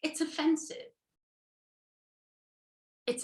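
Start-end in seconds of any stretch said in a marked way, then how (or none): 0.0-0.9 high mock-offended orchid; sensitive orchid. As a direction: object in a high, put-on hurt voice. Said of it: "And the orchid goes".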